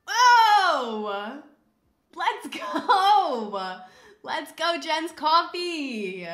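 high, loud, drawn-out celebration; triumphant shouting. Real, not acted. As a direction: cheer wildly, stretching the last words. Celebrating a correct guess.

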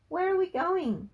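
high, loud alarmed question; urgent question. A narrator's reading. Frankie dragged to shed by granddad, alarmed. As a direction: blurt it out while being dragged.

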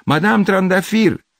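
very high, loud, long-drawn call; urgent drawn-out holler; shouting a name. A narrator's reading. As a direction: yell up at a neighbour's window. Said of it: "strigă".

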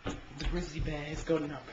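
hushed wildlife documentary voice; documentary narrator. Acted. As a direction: narrate like a nature show. Nature documentary narration of siblings.

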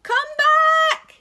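very high, loud call across the sea; shouting. Acted. Bernard yelling to the rabbits at sea, loud. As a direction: yell it out to the rabbits far away. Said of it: "he shouted".

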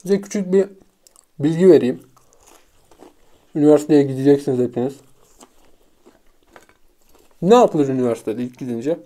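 talking while chewing; muffled between bites; mouth full of pizza. Real, not acted. Seen 0: Bites pizza at +1.5, lips closed chewing through the words.

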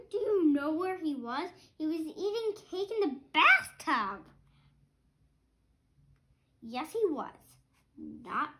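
lively storybook read-aloud; reading to children. A narrator's reading. Parent reading a picture book to a child, lively. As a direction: read it like a bedtime book for kids.

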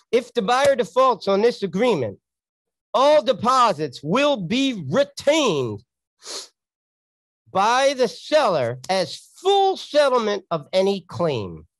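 formal contract reading. Acted. Reading contract clause aloud.

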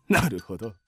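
very low, soft aside; subdued muttering. Acted. Man taking stock after declaring his love, subdued. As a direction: mutter it to yourself. Man pauses amid tirade.

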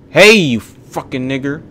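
loud, high outburst at a driver; angry yell. Real, not acted. Gamer to another driver in the game, angry yell. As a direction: yell angrily at someone cutting across you.

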